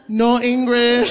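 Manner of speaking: "No English" slow and high, with a laugh; mock Filipino accent. Telling the punchline, laughing accented "No English". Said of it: "before saying".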